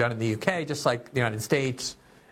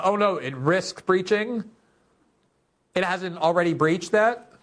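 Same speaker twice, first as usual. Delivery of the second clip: sarcastic disbelief; 6 semitones higher than usual. Real, not acted. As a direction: scoff at how mild it sounds. Mocking the statement's weak wording.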